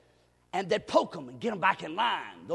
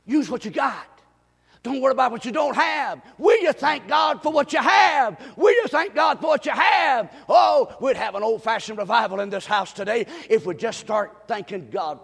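loud, high pulpit shouting; impassioned revival preaching; full-force preaching; 5 semitones higher, 9 dB louder than usual. Real, not acted. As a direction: thunder the examples at the congregation, punching each phrase.

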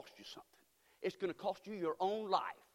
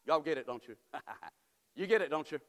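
ordinary delivery

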